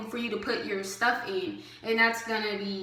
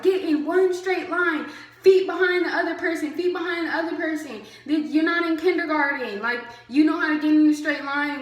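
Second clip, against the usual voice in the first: higher, louder drill-sergeant scolding; bossy scolding; 5 semitones higher, 4 dB louder than usual. Retold or imitated. Officer pushing recruits into line, scolding loudly. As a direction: scold them like misbehaving kindergartners.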